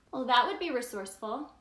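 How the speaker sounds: ordinary delivery, nothing marked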